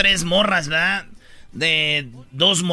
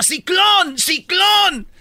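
much higher, louder shrieked warning; panicked scream; 12 semitones higher, 3 dB louder, much slower than usual. Retold or imitated. The condemned woman screams at the soldiers in a high panicked yell. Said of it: "y luego grita".

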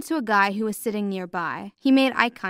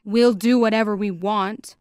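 louder, harsh retort; harsh reply; 6 dB louder than usual. A narrator's reading. Masked man answering the pleading father, harsh. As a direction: throw it back harshly, with force. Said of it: "one of the men replied harshly".

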